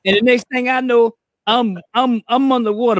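ordinary delivery

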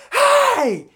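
loud high yell of protest; shout. Retold or imitated. Cousin yelling at the kids who threw the balloon, loud high shout.

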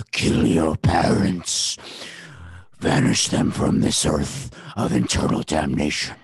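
slow, low, whispery demon; a growling voice in his head. Acted. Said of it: "It's my demon voice".

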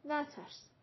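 speaking low. A narrator's reading. Siah reassures the stage manager, speaking quietly. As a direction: drop the volume to reassure discreetly. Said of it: "سیاه آهسته گفت".

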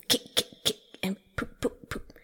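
stifled little snorts and pops; soft voiceless bursts, like soda bottles opening; suppressed noises. A narrator's reading. Toad holds in noises while Rat lectures, suppressed snorts. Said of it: "making strange suppressed noises inside him".

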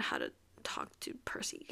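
low exasperated whisper; whispered frustration with a breathy laugh. Real, not acted. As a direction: drop to a whisper in disbelief. Exasperated aside.

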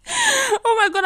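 real high, loud burst of laughter; laughing. Real, not acted. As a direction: laugh helplessly through slow, drawn-out words. Laughs at her own remark.

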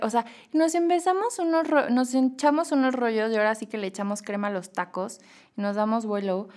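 ordinary talk, nothing marked

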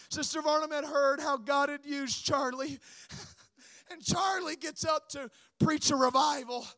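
ordinary talk, nothing marked

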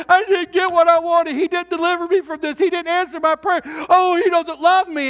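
a believer griping that God failed him, hurt; bitter complaining believer. Retold or imitated. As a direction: whine like a let-down believer. Voicing disappointed believer.

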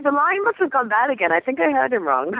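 confused. Retold or imitated. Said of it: "I was like".

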